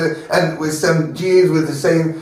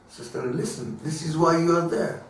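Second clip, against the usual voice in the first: gentle urging; 8 dB quieter than usual. Retold or imitated. Mordechai answers Esther.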